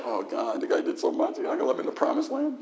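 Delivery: pleading with God, high and fast at the end; a hint of Moses protesting to God; acted Moses pleading. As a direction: Moses begging God for the promised land. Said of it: "Little dramatic presentation".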